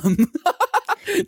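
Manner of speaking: high laughing burst; burst of laughter. Real, not acted. Host cracks up at his own dialect singing, laughing.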